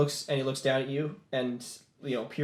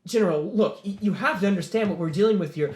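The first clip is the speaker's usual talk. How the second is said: higher, louder, stumbling appeal; protesting officer; 6 semitones higher, 5 dB louder than usual. Acted. Officer arguing with the general, urgent protest. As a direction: plead your case urgently.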